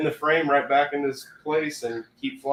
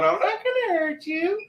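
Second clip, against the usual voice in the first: high squawky parrot imitation, much higher. Retelling a TikTok to a friend, in a high parrot squawk. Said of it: "it just keeps going".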